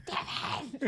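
shrill ghostly whisper; hissing whisper. Retold or imitated. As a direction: hiss the name in a ghostly whisper. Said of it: "In a shrill whisper".

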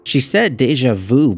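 put-on high, impatient girl's correction; correcting girl. A narrator's reading. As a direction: correct your sister in a high child's voice. Girl corrects her sister.

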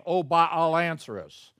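a loud mock plea to Baal; calling out. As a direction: cry out to the idol, half-acted. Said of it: "called upon the name of Baal".

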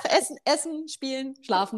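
put-on high, playful list like a toddler's wishes; childlike listing. Teacher imitates a child's simple needs, high and playful. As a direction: rattle off the basics as a small child would.

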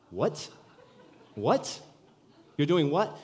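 can't believe what he's hearing; shocked husband on phone, climbing disbelief; stunned disbelief. Retold or imitated. Shocked at sudden news.

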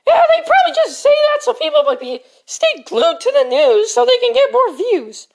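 whiny pleading. Acted. Pleading over gum.

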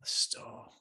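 breathy whispered echo. Shown as a demonstration. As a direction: whisper the word back. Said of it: "I'm going with the alliteration".

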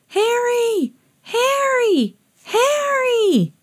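very high long calls from afar; calling. A narrator's reading. Hot dog man heard calling, calling out. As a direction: call out across the beach.

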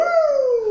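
a big delighted laugh; loud high laugh. Real, not acted. Laughing hard at his own Macho Man story.

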